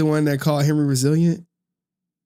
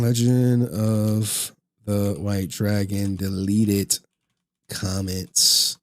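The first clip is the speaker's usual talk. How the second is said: slow, low, drawn-out words; absent-minded, low and stretched out; slow dictation; 8 semitones lower, much slower than usual. Real, not acted. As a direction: sound out each word as if typing it. Saying a title while entering it.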